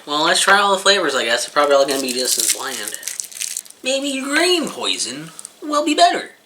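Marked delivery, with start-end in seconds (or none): 3.8-6.3 high, slow joking aside; deadpan joke. Real, not acted. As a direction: toss off the joke slowly. Joke about eating poison.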